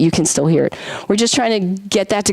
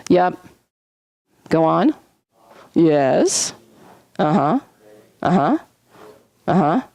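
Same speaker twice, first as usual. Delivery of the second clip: ordinary delivery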